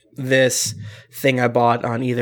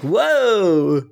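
dizzy whoa; 6 semitones higher, 3 dB louder than usual. Retold or imitated. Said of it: "but you're like".

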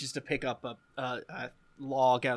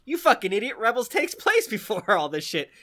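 high, loud mock scolding; mock angry insult; 6 semitones higher, 5 dB louder than usual. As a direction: snap at yourself in jest.

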